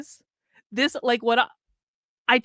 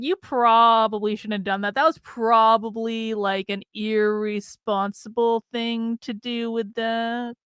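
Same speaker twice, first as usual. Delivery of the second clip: slow, drawn-out words of regret; retold self-reproach; slower than usual. Telling herself off after messaging him, slow guilty retelling. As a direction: stretch PROBABLY as the doubt sinks in.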